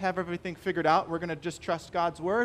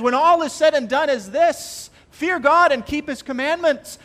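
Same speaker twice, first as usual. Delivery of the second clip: solemn proclamation; 7 semitones higher, 8 dB louder than usual. Retold or imitated. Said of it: "he says".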